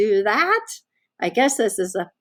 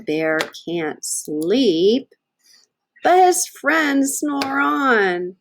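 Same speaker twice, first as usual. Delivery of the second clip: slow drawn-out storybook ending; slow refrain; 3 semitones higher, much slower than usual. Acted. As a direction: let the last refrain linger. Refrain read to children.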